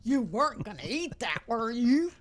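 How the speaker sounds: mock menacing villain line; hammed-up villain threat. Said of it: "Is that your villain voice?".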